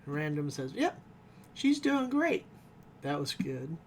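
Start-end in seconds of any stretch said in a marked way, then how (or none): 0.7-2.5 much higher, louder chirpy voice; cheery put-on voice, sarcastic; sarcastic reassurance. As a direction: chirp it as an obvious fib. Rutherford's ironic reassurance.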